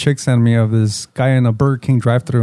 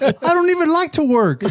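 high whiny voice; 14 semitones higher than usual. Retold or imitated. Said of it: "He's like".

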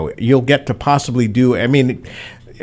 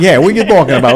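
mock Rhode Island accent; 5 semitones higher, 5 dB louder, much faster than usual. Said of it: "the Rhode Island accent's gonna kill".

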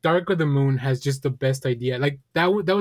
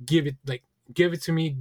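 ordinary delivery

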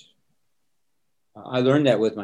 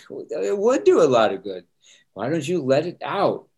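higher and louder, pressing the point; insistent pleading; 5 semitones higher, 4 dB louder than usual. Retold or imitated. Son insisting to his dad tears help, higher insistent plea. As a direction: push back hard but kindly.

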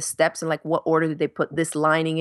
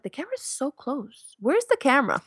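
a hint of high, wondering viewers; puzzled commenters; 7 semitones higher than usual. Viewers asking where the camera is, lightly puzzled.

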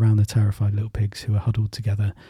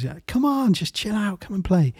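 much higher, whiny pleading; high pleading call; 8 semitones higher, 5 dB quieter than usual. Acted. Brothers plead with third pig.

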